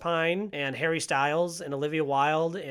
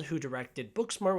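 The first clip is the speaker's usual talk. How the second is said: usual speaking voice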